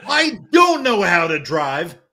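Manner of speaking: high, loud wail of protest; slow, high-pitched exclamation; loud outburst. Real, not acted. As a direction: cry it out, stretching the words. Outburst after teasing about driving.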